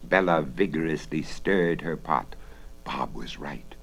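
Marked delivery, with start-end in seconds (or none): 2.8-3.7 whispering. A narrator's reading. Narrator hushes for suspense.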